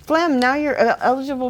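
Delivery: high-pitched, delighted shout-out; gushing, high-pitched gratitude; excited thanks. Real, not acted. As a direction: thank a supporter with real excitement, voice lifted high. Thanking viewer for gifted sub.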